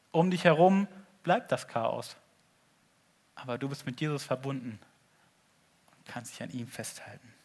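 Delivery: usual speaking voice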